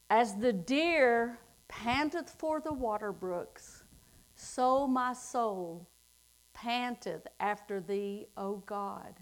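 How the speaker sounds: slow, hushed reading of the psalm; scripture reading. Acted. Preacher reads the psalm to the congregation, slow and hushed. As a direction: read it slowly, letting it sink in.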